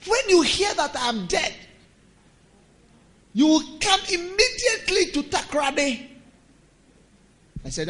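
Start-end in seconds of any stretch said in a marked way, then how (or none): 0.1-6.1 put-on higher, louder old man's plea; father-in-law pleading. Old father-in-law begging his son-in-law to come. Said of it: "he said".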